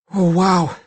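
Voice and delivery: put-on a child's gasp at a slip; gasped dismay. A narrator's reading. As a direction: blurt it as a sudden realization. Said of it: "he gasped".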